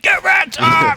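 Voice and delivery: high, loud mock trash-talk shout; imitated yell. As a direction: belt it like a player yelling across the room. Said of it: "Divios yelling".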